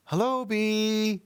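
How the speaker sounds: drawn-out eager hello; boy greeting a bee with a slow, high hello; slow bright boy voice. Acted.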